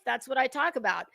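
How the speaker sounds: ordinary talk, nothing marked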